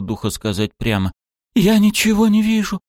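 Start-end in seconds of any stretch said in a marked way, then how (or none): 1.5-2.8 much higher, loud panicked cry. A narrator's reading. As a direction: voice breaking into a scream. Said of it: "Её голос сорвался на крик".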